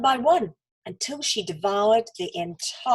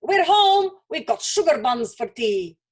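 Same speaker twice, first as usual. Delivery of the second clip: calling out; 3 semitones higher, 5 dB louder than usual. A narrator's reading. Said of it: "they called".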